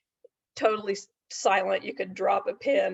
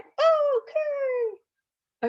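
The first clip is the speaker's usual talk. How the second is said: much higher drawn-out puppet okay; a long, high puppet okay; 3 dB louder than usual. Acted. Puppet agreeing to hear the story, higher puppet voice.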